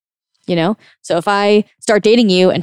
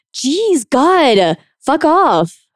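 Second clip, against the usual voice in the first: high, loud exasperated blow-up; overreacting outburst; 8 semitones higher, 4 dB louder, much slower than usual. Shown as a demonstration. Coach showing an overreaction to a nitpicking boyfriend, loud and high. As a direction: explode way out of proportion. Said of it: "me sort of overreacting".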